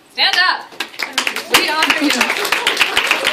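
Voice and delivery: loud rallying call to the room; loud, celebratory shout-out over applause. Real, not acted. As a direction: raise your voice to honor the winners. Host calls achievers to stand.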